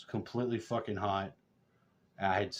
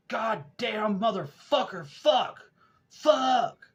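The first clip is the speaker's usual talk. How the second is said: imitated cursing yelled very high and loud; angry cussing; 13 semitones higher, 6 dB louder, much slower than usual. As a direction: howl slow curses, building to a louder yell. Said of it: "We could hear this cussing".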